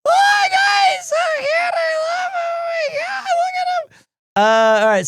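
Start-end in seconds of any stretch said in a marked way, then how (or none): 0.1-3.9 very high squeaky gushing; squealing excitement. Real, not acted. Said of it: "I'm getting a little excited here".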